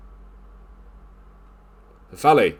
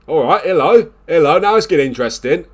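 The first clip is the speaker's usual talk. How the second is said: higher and louder with glee; excited; 5 semitones higher, 6 dB louder than usual. Real, not acted. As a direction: relish the turn of the game. Rival down to ten men.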